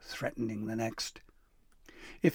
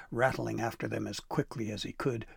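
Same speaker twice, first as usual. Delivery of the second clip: plain reading voice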